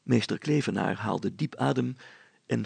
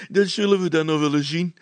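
higher, louder, laughing defiance; the young man laughing back at the constable, higher and louder; 7 semitones higher, 8 dB louder than usual. Acted. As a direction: laugh it back in his face. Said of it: "lachte de jonge man van Fantine".

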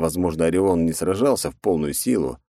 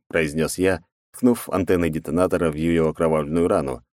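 plain reading voice

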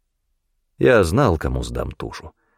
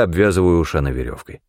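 ordinary delivery

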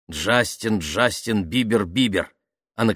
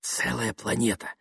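soft, hushed and dreamy; dreamy whisper; 3 semitones higher, 6 dB quieter than usual. A narrator's reading. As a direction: breathe out a secret fantasy quietly. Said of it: "зашептала она".

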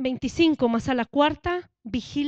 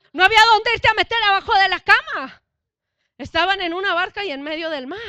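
louder, higher sermon delivery; preaching at full force; 4 semitones higher, 12 dB louder than usual. Acted. Preacher pressing the congregation, at full force. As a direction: drive the point home to the congregation.

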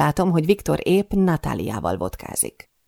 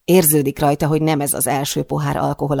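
ordinary delivery, nothing marked

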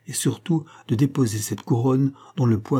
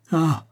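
a sharp, high cry of distress; pained cry ah; 6 semitones higher than usual. A narrator's reading. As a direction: cry out as she throws herself toward him. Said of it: "s'écria-t-elle".

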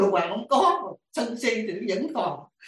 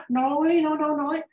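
mimed endless talking; 8 semitones higher than usual. Retold or imitated. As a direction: stretch it to show someone going on and on. Tả cô kia nói hoài. (kéo dài giọng, tả nói hoài)

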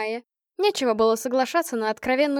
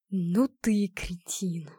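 slow whispered hiss, through teeth; 4 semitones lower, 3 dB quieter, much slower than usual. A narrator's reading. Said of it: "прошипел он сквозь зубы".

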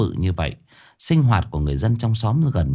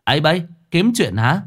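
challenging taunt; 6 semitones higher than usual. A narrator's reading. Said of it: "Thằng Địa ngước lên".

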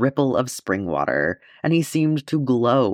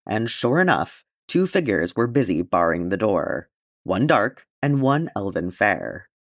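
plain reading voice

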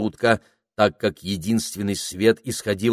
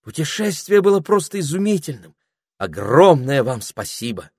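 put-on higher, delighted girl voice; 7 semitones higher, 4 dB louder than usual. A narrator's reading. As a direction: thank her brightly. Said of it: "сказала Пенелопа".

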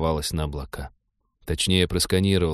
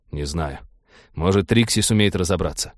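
ordinary delivery

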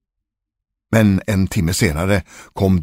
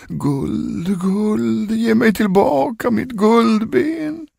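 high, long-drawn wail on one note; ghostly calling voice; much slower than usual. A narrator's reading. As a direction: wail it from beyond the grave. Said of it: "rösten ropade upp ur graven". (klagande, utdraget spökrop)

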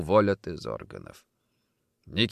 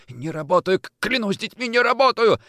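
high, stammered oath, building louder; desperate denial; 11 semitones higher, 3 dB louder than usual. Acted. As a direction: swear innocence while being choked. Strangled man swears innocence.

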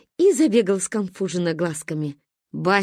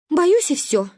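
scared; 8 semitones higher, 4 dB louder, slower than usual. Acted. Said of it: "зуб на зуб от страха не попадал".

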